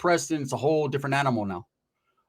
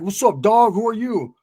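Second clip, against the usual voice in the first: loud challenge; 7 semitones higher, 5 dB louder than usual. Retold or imitated. Rival challenging from the cell.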